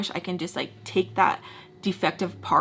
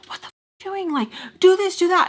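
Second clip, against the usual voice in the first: high, loud imitated scream; screaming at the screen; 11 semitones higher, 6 dB louder than usual. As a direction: yell frantic orders at a film character. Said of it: "you'll be screaming like".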